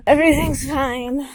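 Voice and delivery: forcing out reassurance while crying. Real, not acted. Runner choking up near the end of the race, crying. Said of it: "I'm just crying right now".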